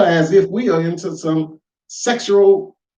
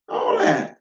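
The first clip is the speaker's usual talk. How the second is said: mocking falsetto. Shown as a demonstration. Mocking echo of own phrase.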